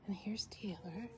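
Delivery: soft unvoiced whisper. Real, not acted. Whispering to viewers as Taylor appears. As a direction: keep it hushed.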